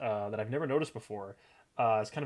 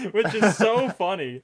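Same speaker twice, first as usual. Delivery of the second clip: higher, slower words through laughter; laughing; 8 semitones higher, 8 dB louder than usual. Real, not acted. Host amused by the extra's eye roll, laughing. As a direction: crack up while you say it.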